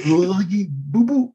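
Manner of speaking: a quick goofy Yogi Bear; Yogi Bear impression. Podcaster mixing up Yogi and Bullwinkle, cartoon bear voice. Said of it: "that's Yogi".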